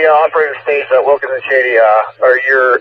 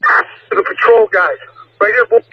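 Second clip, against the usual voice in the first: higher, pressed radio orders; urgent radio call; 8 semitones higher than usual. Real, not acted. Urgent radio orders during shooting.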